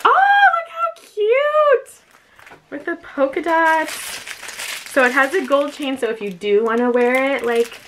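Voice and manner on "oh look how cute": very high, stretched-out squeal; delighted squeal. Real, not acted. Vlogger opening her new clutch, delighted squeal. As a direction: squeal slowly over something adorable. Opens clutch, sees cute lining.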